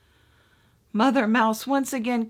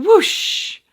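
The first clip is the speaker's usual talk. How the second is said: whoosh; 10 semitones higher, 9 dB louder than usual. A narrator's reading. Wind blows bed apart.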